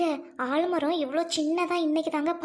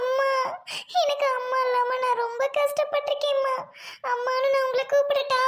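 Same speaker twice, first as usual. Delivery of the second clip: put-on higher, tearful pleading child; child crying; 6 semitones higher than usual. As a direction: ask through sobs to call her mother. (அழுதபடி கெஞ்சும் குழந்தை)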